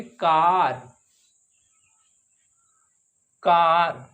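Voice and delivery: कार with a long open aa; long aa sound. Shown as a demonstration. Teacher showing the long aa vowel to the class. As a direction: draw out the aa in कार. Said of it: "आ की".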